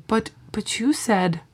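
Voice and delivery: soft, hurt little plea, lightly acted; retold sad plea. Friend retelling Balki's heartbroken phone call, mild sad voice. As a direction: quietly echo the heartbroken line. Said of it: "and he's all sad".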